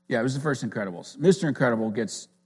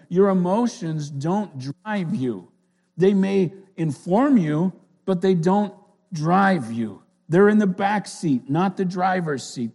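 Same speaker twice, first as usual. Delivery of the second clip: higher, louder, fervent preaching; 8 semitones higher, 8 dB louder than usual. Acted. Pastor urging the church to keep calm, preaching hard. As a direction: drive the point home from the pulpit.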